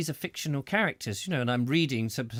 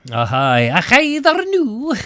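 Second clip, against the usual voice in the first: much higher, much louder put-on Scottish greeting; a hammed-up unconvincing Scottish accent; attempted Scottish accent; 11 semitones higher, 14 dB louder, slower than usual. Host greeting Mikey after his Burns poem, attempted Scottish accent. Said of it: "I wish I could do a, a convincing Scottish accent".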